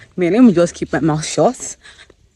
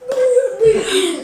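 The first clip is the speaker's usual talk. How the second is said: crying; 4 dB louder than usual. Real, not acted. Author praising God on camera, really crying. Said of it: "I cried, guys".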